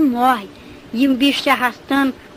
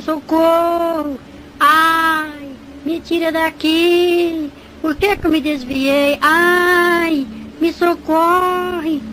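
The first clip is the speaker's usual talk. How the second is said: drawn-out, higher-pitched wailing pleas; imitated tormented cries; 4 semitones higher, much slower than usual. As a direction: cry out for rescue, stretching the last vowels. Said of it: "comecei a escutar os gritos das pessoas". (gritos arrastados de tormento, imitados)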